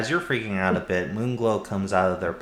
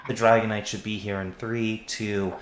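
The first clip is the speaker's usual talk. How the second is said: usual speaking voice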